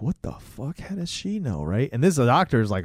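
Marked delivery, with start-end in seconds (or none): 0.0-1.6 softer, higher hushed shock; hushed disbelief. Retold or imitated. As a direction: whisper it, spooked. Kids freaked out, hushed.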